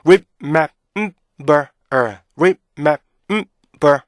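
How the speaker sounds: "rip, map, 음, 버, 어" as detached syllables; loud staccato drill of remember's pieces; beat-by-beat breakdown. Shown as a demonstration. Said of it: "강세가 들어가는 게 가장 좋아요".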